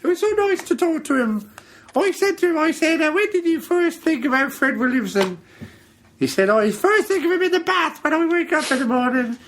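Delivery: high, put-on storyteller voice; silly falsetto. Host invents a chat with the guest, silly falsetto. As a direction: gush through it in mock falsetto.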